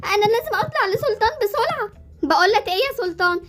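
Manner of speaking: ordinary delivery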